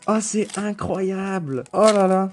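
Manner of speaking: high, slow, drawn-out marvelling; elated amazement. Real, not acted. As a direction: stretch it out in real delight. Overjoyed at a lucky pull.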